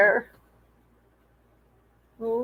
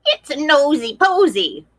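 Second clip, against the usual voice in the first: hen voice, a bit higher; clucking hen; 4 semitones higher than usual. Acted. Hen scolding the nosy pig, clucking voice. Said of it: "clucked Henrietta Hen".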